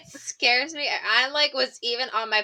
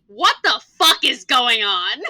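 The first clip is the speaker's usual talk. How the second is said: loud, laughing disbelief; inner outburst; 9 dB louder than usual. Retold or imitated. Teacher's private thought as the class erupts, loud disbelief. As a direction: exclaim a bewildered thought, breaking into laughter. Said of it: "in my head".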